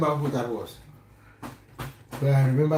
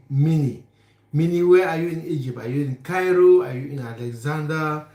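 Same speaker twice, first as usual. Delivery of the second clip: normal talking voice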